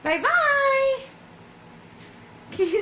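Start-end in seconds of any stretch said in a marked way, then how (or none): 0.0-1.0 high singsong bye-bye. Shown as a demonstration. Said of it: "say bye-bye".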